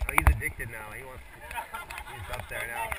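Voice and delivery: ordinary talk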